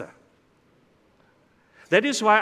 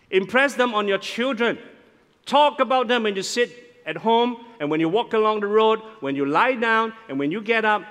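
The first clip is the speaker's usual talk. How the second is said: raised, emphatic Bible reading; scripture reading; 5 semitones higher, 3 dB louder than usual. Acted. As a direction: proclaim the verse to the congregation. Reads verse seven aloud.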